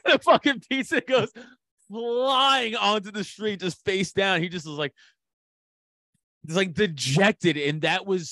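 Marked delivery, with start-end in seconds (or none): 0.0-4.4 laughing. Real, not acted. Laughing while telling the pizza story.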